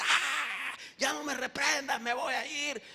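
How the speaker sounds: high-pitched mock demon yell; crying-out demon. Demon begging the one rebuking him, mock cry. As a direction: squeal in surrender like a rebuked demon. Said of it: "el demonio grita".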